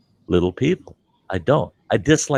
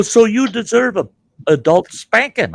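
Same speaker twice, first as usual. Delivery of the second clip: retold indignant threat; 11 semitones higher, 4 dB louder than usual. Retold threat to the small man.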